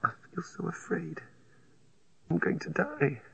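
frightened breathy whisper; afraid whisper. A narrator's reading. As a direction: whisper in quiet dread. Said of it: "Then she changed".

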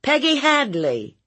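high, pointed interrogation; stern questioning aunt. Acted. Aunt grilling her nephew about Peggy, stern and high. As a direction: press him as a suspicious aunt.

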